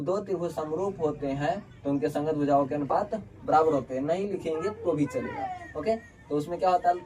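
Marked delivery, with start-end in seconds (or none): none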